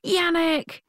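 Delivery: high, drawn-out mock bellow of a name; retold bellow. Presenter re-enacts greeting a supposed friend in the street, bellowed. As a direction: call out YANNICK high and long, re-enacting it.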